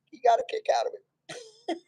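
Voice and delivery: breaks into a high laugh mid-line; can't hold back the giggle. Real, not acted. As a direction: laugh through the words. Laughing while retelling admiral story.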